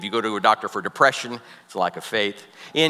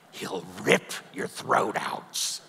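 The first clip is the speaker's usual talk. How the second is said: ominous warning; 6 semitones lower, 6 dB quieter, much slower than usual. Retold or imitated. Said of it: "and he says".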